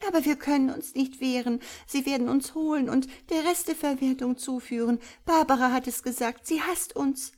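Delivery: high, loud anxious little voice; frightened protest. A narrator's reading. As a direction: plead in high-pitched alarm. Verängstigte Bibi widerspricht. (ängstlich klagend, hoch und aufgeregt)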